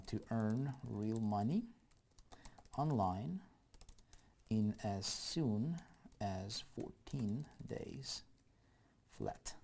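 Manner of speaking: slow, soft dictation of ad copy; slow typing dictation. Acted. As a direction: spell the copy out slowly while you type it.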